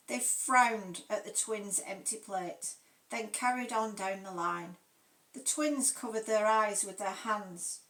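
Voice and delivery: usual speaking voice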